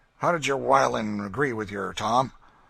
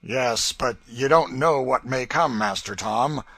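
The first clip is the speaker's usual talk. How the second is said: loud, higher, mocking menace; gloating threat; 5 semitones higher, 10 dB louder than usual. A narrator's reading. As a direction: relish the threat of burning him. Legree threatening torture.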